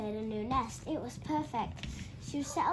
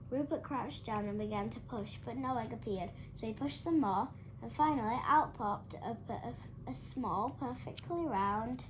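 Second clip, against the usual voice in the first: ordinary delivery, nothing marked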